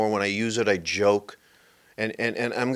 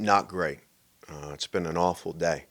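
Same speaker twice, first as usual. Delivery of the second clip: glum honest answer; 5 semitones lower, 7 dB quieter than usual. Acted.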